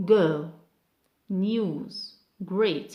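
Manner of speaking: GO, NEWS, GREAT each punched alone; whole-word stress. Shown as a demonstration. Teacher modelling one-syllable words, stressed whole. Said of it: "we put stress on the whole word".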